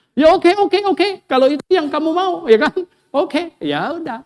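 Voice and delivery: high, loud, smug okay; smug agreement. Retold or imitated. Laban smugly accepting Yakub's deal, thinking he wins. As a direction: agree like you've won the bargain.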